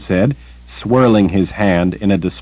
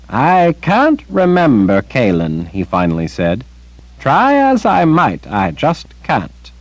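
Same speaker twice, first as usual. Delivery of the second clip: he tries and fails to remember; regretful Zed; 7 semitones higher than usual. Acted. Zed laments lost memory.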